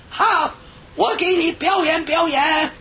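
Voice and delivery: put-on high, drawn-out foreign-accented Chinese; foreigner's Chinese accent. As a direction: imitate an Australian woman's slow, singsong Chinese accent. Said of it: "外国人会说几句中国话". (高声慢速模仿外国人中文口音)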